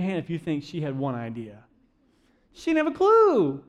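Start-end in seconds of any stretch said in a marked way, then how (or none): 2.5-3.6 high-pitched incredulous exclamation; emphatic punchline. Real, not acted. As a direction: let the voice leap way up. Punchline about the forgetful niece.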